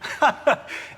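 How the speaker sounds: short, high dismissive laugh; scoffing laugh. Acted. Movie star threatened with the mines, scoffing laugh.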